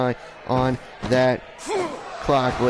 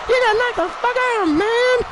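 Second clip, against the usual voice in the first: very high-pitched squealed movie-quote impression; Chris Tucker. Commentator imagining a comedian jumping the cage, high squealed impression. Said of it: "I want Chris Tucker to jump the cage and go".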